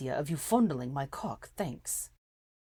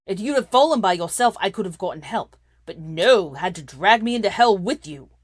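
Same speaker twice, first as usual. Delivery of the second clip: angry loud; 3 semitones higher, 13 dB louder than usual. A narrator's reading.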